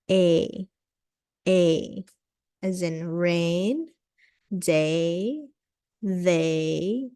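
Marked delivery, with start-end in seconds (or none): 0.1-2.0 A said twice, a bit louder; modelled A sound. Shown as a demonstration. Teacher drilling the A sound for students. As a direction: model the A clearly. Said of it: "that was the A sound".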